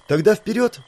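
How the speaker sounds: bright eager call, high; rally everyone forward. Acted. Eager girl urging onward.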